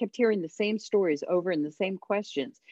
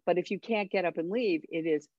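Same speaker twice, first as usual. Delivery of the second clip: ordinary talk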